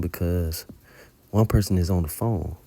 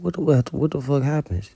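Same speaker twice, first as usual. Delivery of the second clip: retold alarmed question; 6 semitones higher than usual. Acted shocked question in retold dream.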